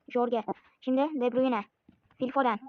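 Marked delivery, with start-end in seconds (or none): none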